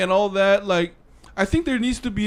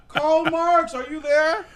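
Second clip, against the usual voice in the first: very high-pitched jokey hail; playful high summons to someone absent; mock calling out; 11 semitones higher, slower than usual. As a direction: call up to a dead thinker, voice pitched way up. Calling up to dead Marx.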